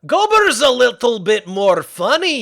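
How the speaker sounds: a hint of the Viking smith; Gobber voice. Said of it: "sounds like Gobber".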